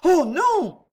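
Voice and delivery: high, loud frightened exclamation. A narrator's reading. Old woman denies seeing the Duc d'Anjou, frightened. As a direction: recoil in alarm, pitch leaping up. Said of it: "Fit la vieille effrayée".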